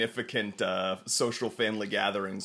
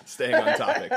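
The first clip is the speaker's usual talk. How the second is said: mock obedient; 6 dB louder than usual. Echoing the mom's phrase.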